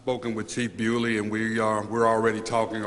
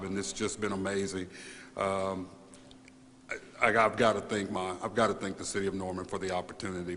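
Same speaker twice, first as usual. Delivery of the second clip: ordinary delivery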